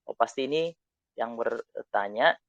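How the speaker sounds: ordinary talk, nothing marked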